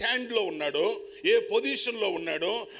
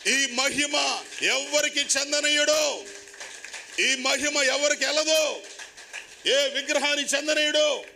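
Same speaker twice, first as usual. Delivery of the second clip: higher, louder, slow hammering declaration; fiery preaching; 7 semitones higher, 3 dB louder than usual. Real, not acted. Preacher insisting to the congregation that glory goes to no idol, fervent. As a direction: drive each line home to the hall.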